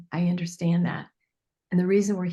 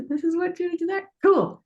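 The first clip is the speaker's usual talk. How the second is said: excited realization; 10 semitones higher, 4 dB louder than usual. Retold or imitated. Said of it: "then you go".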